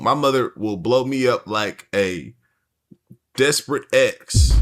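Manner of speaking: ordinary talk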